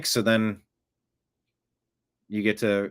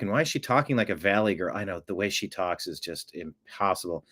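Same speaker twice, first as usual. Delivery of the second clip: ordinary delivery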